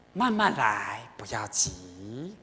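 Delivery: slow it right down like a kindly master calming a student; tender, unhurried advice; gentle reassurance. Retold or imitated. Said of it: "所以法王跟我说".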